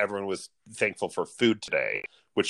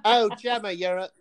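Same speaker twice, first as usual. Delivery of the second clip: mock dramatic; 11 semitones higher than usual. Mock mermaid show quote.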